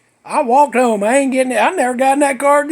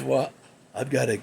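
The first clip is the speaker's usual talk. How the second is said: much lower, softer stoner voice; stoner buddy; 13 semitones lower, 8 dB quieter than usual. Retold or imitated. Buddy in the car asking the dudes for papers, in a much lower, softer voice. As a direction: drop way down into the weed-hunting buddy.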